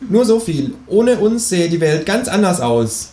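high, secretive creature voice; Känguru. A narrator's reading. Kangaroo keeps conspiracy secret from companion, high coy voice. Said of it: "sagt das Känguru".

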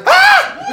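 very high, loud mock shriek; sudden falsetto cry of scalded pain; shrieked yelp. Wife scalded by hot broth down her cleavage, shrieking. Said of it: "gerufen, weil es so heiß".

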